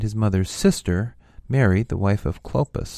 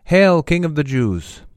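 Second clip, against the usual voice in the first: higher, louder acclamation; loud hail; 6 semitones higher, 6 dB louder than usual. A narrator's reading. Soldiers to their thorn-crowned prisoner, loud hail. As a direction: call it out like a salute.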